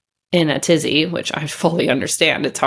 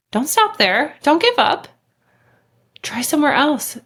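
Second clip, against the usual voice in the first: encouraging; 7 semitones higher than usual. Real, not acted. Urging viewers not to give up.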